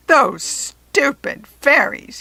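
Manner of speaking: slow, loud gleeful sneer with a chuckle; chortling villain. A narrator's reading. Jack Frost chortles over the fairies, slow and gloating. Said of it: "he chortled".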